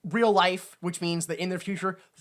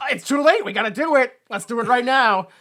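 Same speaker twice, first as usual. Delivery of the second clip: raised, loud, insistent push; mock urgency; 5 semitones higher, 7 dB louder than usual. Victor insisting on running the machine, mock urgent. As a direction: urge them on in a hammed-up voice.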